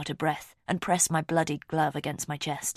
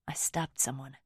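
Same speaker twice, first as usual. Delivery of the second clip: whispering; 3 semitones lower, 7 dB quieter than usual. A narrator's reading. Horrified realisation, whispered.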